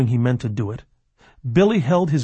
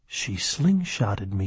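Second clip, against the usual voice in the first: softer, lower, slow thought to oneself; inner thought; 5 semitones lower, 5 dB quieter, slower than usual. A narrator's reading. Billy realizes the girl has shot his hand, slow hushed thought. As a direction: think it slowly, half under the voice. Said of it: "he thought".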